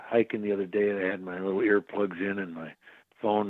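ordinary talk

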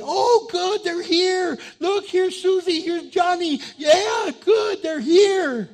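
high, loud, delighted shout; glad welcome. Acted. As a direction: cheer their arrival at full voice. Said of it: "Are they glad when you're near?".

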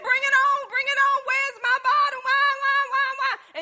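high-pitched mock baby wailing; hammed-up, insistent high wail; crying hungry baby. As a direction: imitate a baby crying for milk. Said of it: "they just cry and cry".

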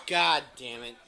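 usual speaking voice

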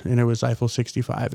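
ordinary delivery

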